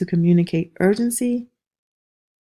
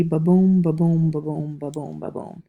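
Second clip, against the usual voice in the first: even "ba-boom" beats fading away; iambic rhythm. Shown as a demonstration. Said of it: "a typical line would be".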